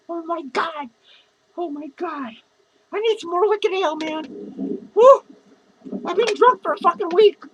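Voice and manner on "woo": loud, high-pitched whoop. Real, not acted. Drunk vlogger wanting more wicked ale, a loud whoop. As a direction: holler a big "woo".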